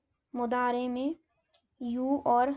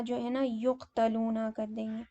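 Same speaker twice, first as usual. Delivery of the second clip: normal talking voice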